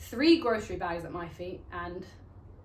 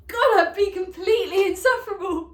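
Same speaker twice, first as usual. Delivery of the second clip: loud, high, self-mocking exclamation; exclaimed outburst; 9 semitones higher, 9 dB louder than usual. Mock outburst at her own ambition.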